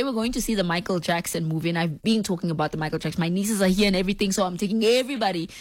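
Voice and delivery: ordinary talk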